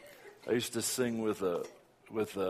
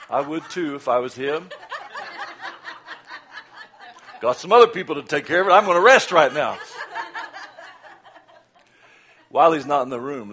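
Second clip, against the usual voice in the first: loud, fast, laughing aside; laughing joke; 6 semitones higher, 12 dB louder than usual. Real, not acted. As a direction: joke about the pastor with a laugh.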